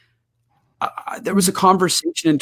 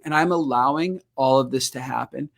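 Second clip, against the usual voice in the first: ordinary talk, nothing marked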